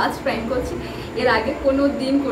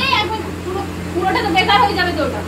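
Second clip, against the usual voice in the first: higher, loud pretend telling-off; mock scolding; 4 semitones higher, 4 dB louder than usual. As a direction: pretend to blame them, pitched up. Fake scolding during the prank. (ভান করে বকুনি, উঁচু গলায়)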